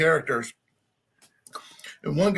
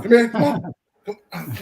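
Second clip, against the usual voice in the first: louder, higher coaxing call; calling the dog; 6 semitones higher, 5 dB louder than usual. Real, not acted. Owner loudly coaxing her dog from under bed.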